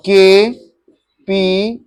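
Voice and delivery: ordinary talk, nothing marked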